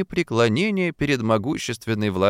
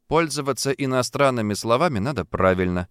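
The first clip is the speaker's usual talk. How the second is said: ordinary delivery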